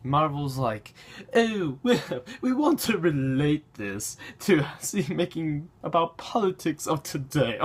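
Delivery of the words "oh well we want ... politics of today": higher-pitched, hammed-up British voice; silly British toff-style parody with little laughs; mock British accent. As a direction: put on a chortling British accent. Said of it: "I'm giving them a British accent".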